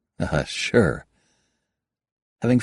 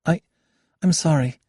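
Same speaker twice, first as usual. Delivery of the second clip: stammering; 10 semitones higher than usual. Acted. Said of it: "she stammered".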